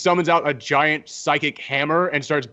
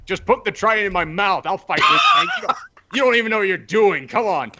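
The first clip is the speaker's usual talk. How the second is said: mock impatient Namor; 5 semitones higher than usual. Voicing Namor, paralyzed and impatient.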